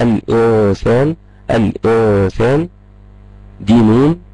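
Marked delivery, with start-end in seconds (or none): 0.0-2.7 slow clear example. Shown as a demonstration. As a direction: stretch الانسان out slowly, every sound distinct. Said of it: "امثلة على الكلام دوت". (نطق بطيء وواضح لكلمة الانسان)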